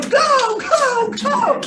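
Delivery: high, loud rushing shout; frantic yelling. Retold or imitated. At the top of the stairs hurrying family, loud yelling. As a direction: hurry everyone out the door at full volume.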